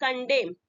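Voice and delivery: कंडेम closing on M; silent N. Shown as a demonstration. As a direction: say कंडेम, final N dropped. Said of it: "correct pronunciation आपका".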